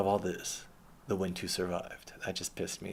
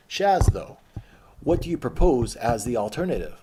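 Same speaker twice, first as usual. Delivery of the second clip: challenging voice; 3 semitones higher, 10 dB louder than usual. Pretend. Voices someone questioning him.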